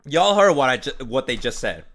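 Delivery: ordinary talk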